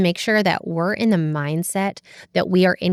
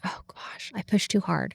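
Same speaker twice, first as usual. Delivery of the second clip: breathy whisper of dismay; whispered regret; 9 dB quieter than usual. Retold or imitated. Mother realizing she was too harsh, whispered. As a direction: gasp it under your breath, caught out. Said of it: "And I'm like".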